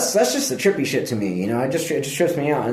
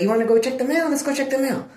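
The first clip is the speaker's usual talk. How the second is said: put-on baby talk to dog; 10 semitones higher, faster than usual. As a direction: sweet-talk the dog into a walk. Talking sweetly to the dog.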